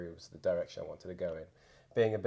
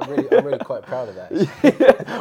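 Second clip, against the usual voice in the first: laughing; 5 semitones higher, 17 dB louder than usual. Real, not acted. Joking pride, laughing through it.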